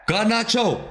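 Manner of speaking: goal celebration. Real, not acted. Fan streamer calling Garnacho's goal to chat, ecstatic rising shout. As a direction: scream it like your team just scored the winner.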